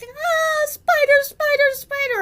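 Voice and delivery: high, loud panicked shrieking; retold scream. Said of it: "she starts screaming".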